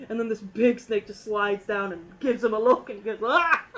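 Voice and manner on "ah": sudden high, loud yelp of fright; a short, sharp cry of surprise; startled yell. Retold or imitated. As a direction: shriek like the snake just lunged at you. Snake scares guy, acted yelp.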